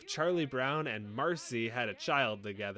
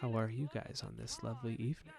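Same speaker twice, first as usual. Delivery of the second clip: soft, lowered ASMR greeting; mock ASMR; 3 semitones lower, 7 dB quieter than usual. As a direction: pretend to host ASMR, softly. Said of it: "was really into A S M R".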